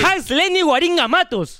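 high-pitched donor announcement; name announcement. Pretend.

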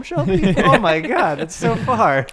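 loud, high disbelief; shocked. Real, not acted. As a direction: cry out in shock.

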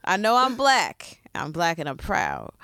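normal talking voice

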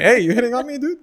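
high, loud, laughing challenge; playfully scandalised comeback; mock indignation. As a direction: act affronted, half laughing. Acted indignant reply in imagined joke.

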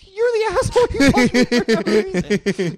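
high, loud retort through laughter; crying laughing. Retold or imitated. Man laughing at the woman who hit him, helpless laughter. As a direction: shout it back while cracking up, very high. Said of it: "Crying laughing".

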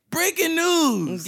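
slow, high, hammed-up newscaster announcement; mock news anchor. Mocking the network for the group, a hammed-up anchor voice.

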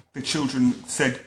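ordinary delivery, nothing marked

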